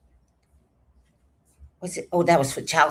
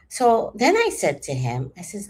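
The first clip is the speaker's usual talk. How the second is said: usual speaking voice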